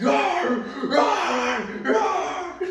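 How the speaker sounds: loud, high-pitched imitated dog roars; screaming dog roar. Dog biting dad's hand, screaming roars. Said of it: "It's like he's screaming".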